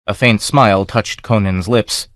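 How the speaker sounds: usual speaking voice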